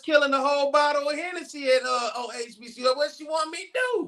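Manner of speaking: half-shouted joking protest; mock protest. Talking over the cohost, a loud joking protest about his mom. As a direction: shout it in mock defense of the drinking.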